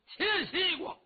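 high, loud battle cry; bellowed war cry at a high pitch; full-throated yell. Acted. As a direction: yell out the name of the striking move. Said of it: "扯着嗓子喊了一声".

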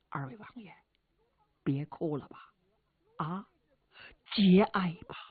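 soft, breathy hush to calm the mourners; old steward gently urges them to stop weeping; hushed consoling. Acted. Old steward consoling grieving brothers, soft hush. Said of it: "就过来劝卢方位置".

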